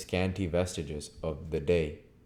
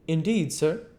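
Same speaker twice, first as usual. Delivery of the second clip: higher, lively innkeeper's wife; landlady voice; 12 semitones higher, 7 dB louder than usual. Acted. Landlady asking the guest's leave, higher voice. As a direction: voice Mrs Hall, pitched up and eager.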